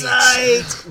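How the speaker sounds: high loud title callout; shout. Real, not acted. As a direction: bellow the show name. Show intro callout.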